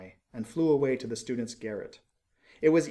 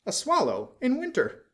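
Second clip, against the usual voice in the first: high, excited cry of discovery; soaring pitch, amazed; astonished professor; 12 semitones higher, 4 dB louder than usual. Acted. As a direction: exclaim it like a scholar spotting a wonder. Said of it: "said the professor of ornithology".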